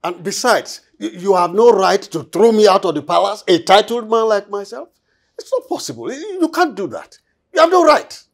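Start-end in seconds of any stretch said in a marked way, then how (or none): 1.0-4.9 loud, outraged protest; indignant shout. Acted. Nobleman protests being thrown out. 7.5-8.2 loud furious protest; angry shout. Acted. Nobleman protests being thrown out.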